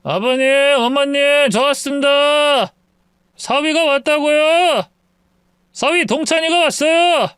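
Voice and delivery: put-on a drunk man hollering at his in-laws' door; drunk son-in-law. (술 취한 사위의 높은 외침)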